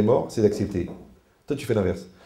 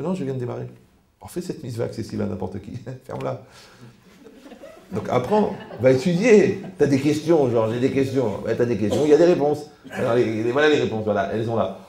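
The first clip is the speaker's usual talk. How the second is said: ordinary talk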